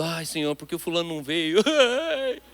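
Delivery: hammed-up whiny prayer breaking into a laugh; mock lament. Pastor mocking a complaining prayer, laughing through it. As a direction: parody a self-pitying prayer, laughing at it.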